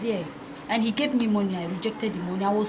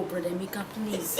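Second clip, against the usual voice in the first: whispered urgent plea; hushed plea; 3 semitones lower, 9 dB quieter than usual. Acted. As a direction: whisper it so nobody hears. Begging him to leave quietly.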